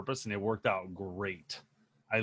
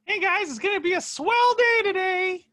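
high chipmunk voice; 8 dB louder than usual. Pretend. Cheery put-on greeting.

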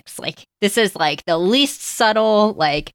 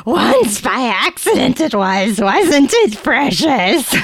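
slow, hammed-up creature voice; Gollum voice; 4 semitones higher, 3 dB louder, slower than usual. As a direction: stretch the words out like a wretched creature. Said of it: "his voice and language changed".

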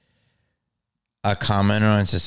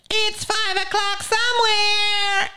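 much higher, slow mock partygoer voice; mocking carefree voice; slower than usual. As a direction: parody a careless drinker, sing-song and high. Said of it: "But people are like".

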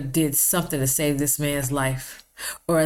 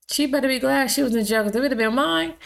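high, fast indignant outburst; 8 semitones higher, faster than usual. Real, not acted. Warning the jailed caregiver, high and indignant. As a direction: fire it off, daring. Outburst about own loved one.

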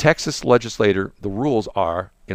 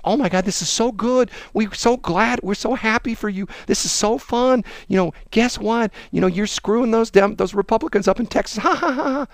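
high, giddy gloating voice; high sarcastic parody laughing at Texas Republicans; mock gushing Harris; 11 semitones higher than usual. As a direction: gush and gloat, then cackle. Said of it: "with Kamala Harris".

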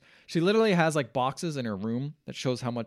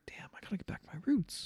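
whispered, higher-pitched murmur to oneself; hushed realization; 7 semitones higher, 7 dB quieter, faster than usual. Retold or imitated. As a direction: voice a character's quiet epiphany, quick and breathy. Retold character's private realization.

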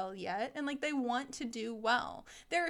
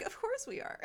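much higher, imitating cocky kids; mock smugness; 13 semitones higher, 4 dB quieter than usual. Cocky pupils to their teacher, high mock-smug voice. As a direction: play the pupil on a high horse.

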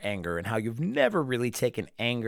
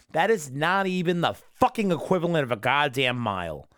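ordinary talk

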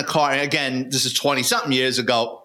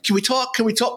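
pleading; 8 semitones higher than usual. Retold or imitated. Tiny begging while being booked.

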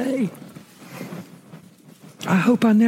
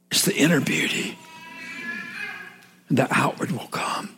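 hushed; 9 semitones higher, 6 dB quieter, faster than usual. Acted. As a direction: breathe the point out softly. Sermon point, said softly.

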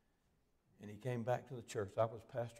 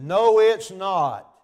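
slow, huge shouted denial, much higher and louder; angry shout; 10 semitones higher, 24 dB louder, much slower than usual. Retold or imitated. Man shouting back at the preacher, furious slow yell. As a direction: roar the denial, drawn out.

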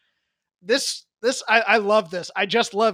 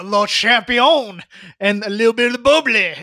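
pretentious French; 5 dB louder than usual. Retold or imitated. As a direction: ham up a pompous French champion. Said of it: "pretentious French thing".

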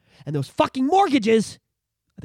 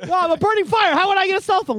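higher, protesting; mock indignant fire; 7 semitones higher, 4 dB louder than usual. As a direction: plead your case as fire. Fire voiced in skit.

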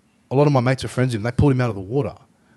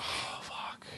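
whispered under the breath; whispered dread; 19 dB quieter than usual. Retold or imitated. Realising the stranger wants a fight, whispered. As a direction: breathe it out in alarm.

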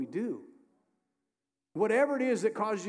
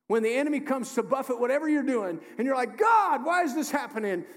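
louder and higher into a desperate cry; building preacher's cry; 6 semitones higher, 5 dB louder than usual. Acted. Pastor voicing a believer crying out, loud and high. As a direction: build to a pleading cry to God.

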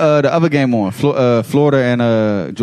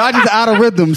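raised, higher call over the others; excited shout; 7 semitones higher than usual. Real, not acted. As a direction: shout the verdict over the crowd. Heated laughing group argument.

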